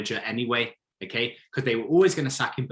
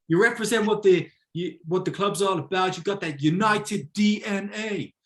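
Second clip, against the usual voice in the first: high, put-on American accent, a bit louder; 8 semitones higher, 3 dB louder than usual. Board flattering Carrick, in a hammed-up American accent. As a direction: gush like an American executive. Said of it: "This is my American accent".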